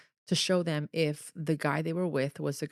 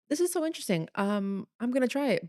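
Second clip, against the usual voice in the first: higher-pitched intrigued self-quote; retold keen interest, pitched up; 7 semitones higher, faster than usual. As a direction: lightly act out your eager first reaction. Retells her keen first reaction.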